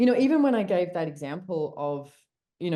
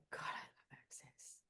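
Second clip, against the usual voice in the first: barely audible, voiceless aside; awed whisper; 23 dB quieter than usual. Retold or imitated. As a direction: whisper it under your breath, retelling a private reaction. Retelling her own awed reaction.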